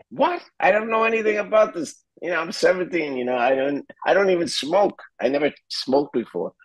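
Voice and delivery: high, fast flustered protest; alarmed protest. Retold or imitated. Seventeen-year-old refusing the acid, high flustered protest. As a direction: babble nervously, caught off guard.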